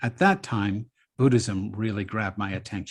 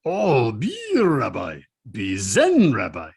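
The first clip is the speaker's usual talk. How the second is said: slow, louder imitation of a Japanese Zen master's accent; 5 dB louder than usual. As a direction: mimic the old Japanese teacher's English, drawn out. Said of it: "sounded something like this. He goes".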